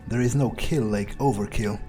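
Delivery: usual speaking voice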